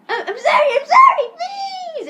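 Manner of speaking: very high, loud wailing apology; hammed-up begging squeal; mock pleading. [name] begs the principal, shrieked mock plea.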